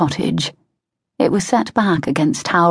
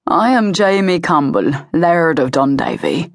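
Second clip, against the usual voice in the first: easy now, a touch of calming a threat; Jamie, Highland laird. A narrator's reading. Jamie announces himself.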